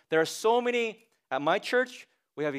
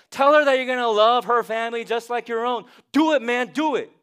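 high, loud pep-talk push; mock hype coaching; 6 semitones higher, 7 dB louder than usual. Counselor hypes up husband Billy, loud and high. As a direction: pump him up like a coach. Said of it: "Is my counsel to Billy like".

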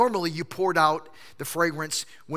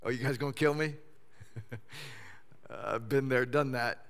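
unbothered Lazarus; 5 semitones lower, 8 dB quieter than usual. Pretend.

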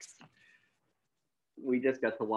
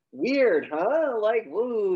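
high, loud, swooping exclamation; mock amazement; 10 semitones higher, 7 dB louder, much slower than usual. Cop feigns small talk near the gunman, loud high mock amazement. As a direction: act out showy surprise, pitched way up. Acting out cop's feigned casual surprise.

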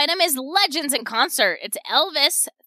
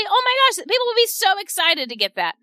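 much higher, gushing with delight; excited gush; 8 semitones higher, faster than usual. Real, not acted. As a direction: sell it like you can't contain yourself. Host gushes about chili gifts.

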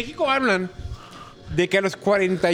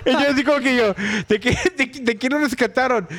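laughing; 4 semitones higher than usual. Real, not acted. Joking about rescuing the dead.

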